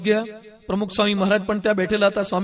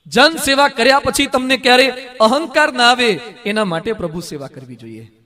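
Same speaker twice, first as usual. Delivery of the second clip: ordinary delivery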